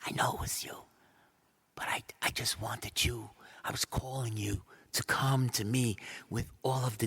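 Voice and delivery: soft, intimate whispered reassurance; a hushed, loving Jesus; tender whisper. Acted. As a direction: comfort her gently in a whisper. Jesus tender to healed woman.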